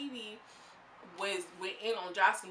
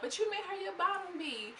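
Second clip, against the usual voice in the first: mock emotional whine; 10 semitones higher than usual. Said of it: "Barbie starts getting emotional".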